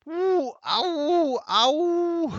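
very high, loud drawn-out howls; hammed-up howl of agony; wailing in pain. A narrator's reading. Seppel fakes a bellyache, wailing. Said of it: "brach in ein dumpfes Gewimmer aus".

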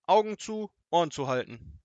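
loud, high robber command; barked order. A narrator's reading. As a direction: bark it at them. (lauter Befehl)

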